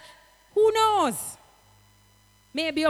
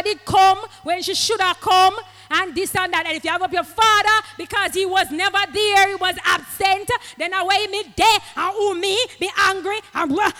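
higher, louder preaching; fervent preaching; 4 semitones higher, 5 dB louder than usual. Acted. Preacher scolding the congregation, fervent preaching. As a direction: drive it home with fire.

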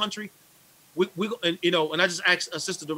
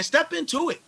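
much higher, urgent repeat; amped; 11 semitones higher than usual. Real, not acted. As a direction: push it harder the second time. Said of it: "I know I'm amped about this stuff".

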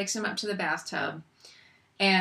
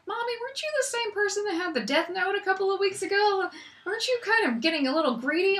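put-on child voice; 9 semitones higher than usual. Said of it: "she'd be like".

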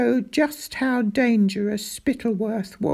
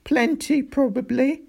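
high, subdued tearful reply; a touch of tears, pitch raised; 7 semitones higher than usual. A narrator's reading. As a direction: answer in the wake of crying. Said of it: "mopping his face with his pyjama sleeve".

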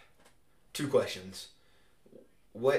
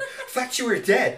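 much higher, louder put-on whine; mock panicked sibling; 12 dB louder than usual. Imitating sibling's frantic call, hammed-up high voice. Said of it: "You're like".

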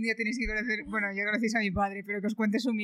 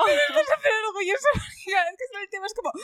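laughing; 10 semitones higher, faster than usual. Real, not acted. Laughing while telling dad story.

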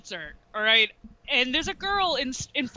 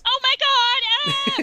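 mock scared teen girl; 13 semitones higher, 5 dB louder, slower than usual. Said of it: "Was it like that?".

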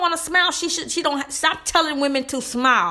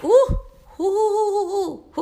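worked-up ooh; 9 semitones higher, slower than usual. Real, not acted. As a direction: shake off the anger with a squeal. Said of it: "got myself upset".

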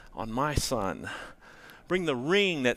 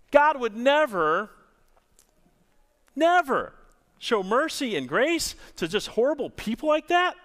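higher, louder, scandalized sneer at the start that fades down to his own pitch; scornful Pharisee; 7 semitones higher, 4 dB louder than usual. Acted. Pharisees scoffing at Jesus for welcoming sinners, scandalized then fading. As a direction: play the outraged religious man who can't believe it. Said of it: "Pharisees and scribes are thinking".

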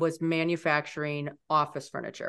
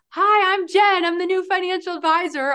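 much higher and louder, bubbly first-day greeting; all excited; 13 semitones higher, 6 dB louder than usual. Retold or imitated. New hire greeting the receptionist on her first day, excited. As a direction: beam it out like the eager new hire. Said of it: "all excited".

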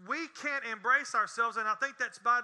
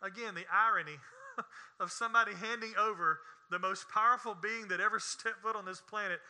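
usual speaking voice

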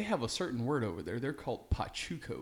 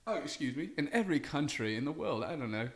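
lightly done cockney bloke, mate-style; put-on British accent. Guest on the show, answering in a light mock British accent. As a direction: play a chatty Brit. S0 plays British character Welvin.

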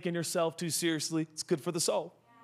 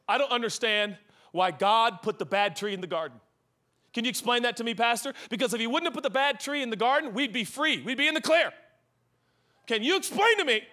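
increasingly intense; 5 semitones higher than usual. Retold or imitated. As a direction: act out a worked-up student challenging his pastor. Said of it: "this guy got so intense".

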